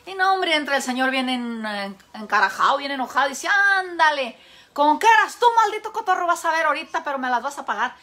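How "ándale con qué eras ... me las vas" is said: loud, higher furious owner yelling at the parrot; angry man yelling at his parrot. Acted. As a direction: bark it out, caught him red-handed.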